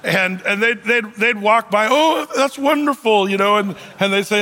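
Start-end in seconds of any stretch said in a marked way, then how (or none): 1.9-3.3 high, slow starstruck gush; a hint of an oblivious admirer; gushing guest. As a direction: gush like a dazzled guest, lightly voiced.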